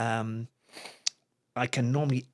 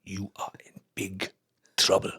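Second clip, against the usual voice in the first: grave quoted warning; 4 semitones lower than usual. Retold or imitated. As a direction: let it sink toward a near-whisper, word by word. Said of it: "And the way he said it".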